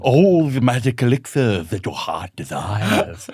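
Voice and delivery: slow, theatrical wizard shopkeeper; slow hammed-up wizard welcoming you to his shop; annoying wizard voice. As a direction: roll it out like a fairground mystic. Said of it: "an incredibly annoying wizard voice".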